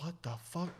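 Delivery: quiet disbelieving curse; under-breath what the fuck. Retold or imitated. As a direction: say it almost to yourself, shocked. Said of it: "I remember looking at you".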